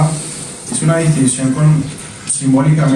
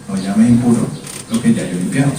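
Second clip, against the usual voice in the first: voice of God; 6 semitones lower, 3 dB quieter, faster than usual. Acted. God answering Pedro in vision.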